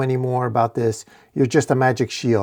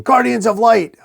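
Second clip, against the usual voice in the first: high, loud battle cry; screaming; 11 semitones higher, 5 dB louder than usual. A narrator's reading. Orc about to chop a knight, screaming. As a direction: call out the enemy at the top of the voice. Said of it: "who's screaming".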